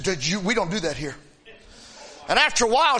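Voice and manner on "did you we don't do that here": low, hushed stern deacon scolding; a touch of a disapproving deacon, lower and softer. Retold or imitated. Deacon hushing the woman in church, stern and low. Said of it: "the deacon stopped her and said".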